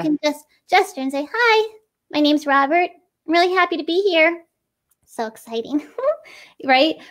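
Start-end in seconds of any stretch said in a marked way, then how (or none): 1.3-5.8 brighter, higher puppet greeting with little inflections; lively puppet intro. Shown as a demonstration. Puppeteer demoing an expressive puppet intro, higher and lively. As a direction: add inflection to each phrase, higher and brighter. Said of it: "we can just add these little inflections".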